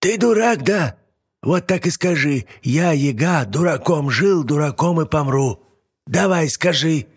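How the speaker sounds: high mocking old witch scolding. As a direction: taunt and scold him. Yaga keeps scolding, hitting.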